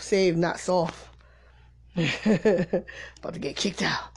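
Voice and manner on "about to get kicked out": laughing whisper. Real, not acted. Joking aside about church shirt.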